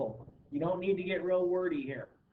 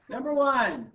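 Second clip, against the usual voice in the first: higher, louder quiz-show announcement; game show host voice; 6 semitones higher, 5 dB louder than usual. Shown as a demonstration. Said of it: "That's my game show host voice".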